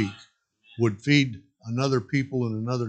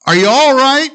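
loud, higher call; 13 semitones higher, 13 dB louder than usual. Acted. The Lord calling down to Jack, loud concerned call. Voice calls down.

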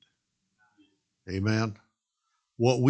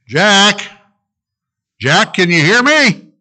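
loud, higher call from above; 8 semitones higher, 12 dB louder, slower than usual. Acted. The Lord calling down to Jack on the cliff, loud call. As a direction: call down to him from far away.

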